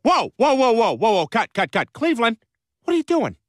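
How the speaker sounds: high, insistent protest; exasperated interruption. Acted. Director calling cut on Cleveland, exasperated. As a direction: break in and halt the take, voice jumping up. Director angrily halts the take.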